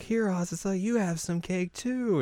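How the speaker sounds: put-on high, slow, lightly coaxing girl voice; sweet offering. Yui offering Azusa cake, sweetly coaxing. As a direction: imitate a friend sweetly tempting someone.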